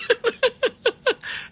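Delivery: shrill mocking cackle; high laugh. Real, not acted. Taunting the reporter with a high laugh.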